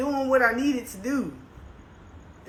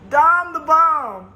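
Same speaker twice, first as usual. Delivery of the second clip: loud drawn-out callout; 5 semitones higher, 8 dB louder, much slower than usual. Real, not acted. Reads a donor name aloud.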